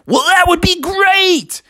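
very high, drawn-out excited frog; thrilled Froggy. Acted. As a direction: squeal your acceptance slowly. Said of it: "And Froggy was like".

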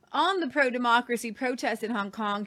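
normal talking voice

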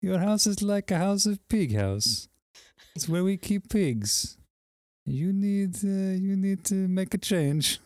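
put-on high, slow Eastern European accent; imitating Vicky's accent. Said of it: "can you do her accent real quick".

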